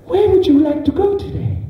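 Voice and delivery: sat-nav cheerfully greeting the driver; chirpy sat-nav voice. Pretend. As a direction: play a cheery navigation device. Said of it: "he's like".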